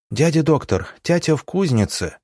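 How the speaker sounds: put-on high-pitched little boy; boy piping up from behind a curtain; thin child's voice. A narrator's reading. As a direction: call out in a small boy's high voice. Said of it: "донёсся тонкий голос из-за занавески".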